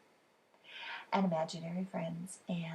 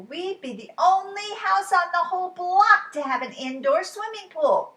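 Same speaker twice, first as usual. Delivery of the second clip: higher and louder, thrilled; excited; 9 semitones higher, 8 dB louder than usual. Acted. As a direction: gush about it like a kid. Child excited about pool.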